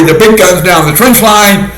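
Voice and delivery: usual speaking voice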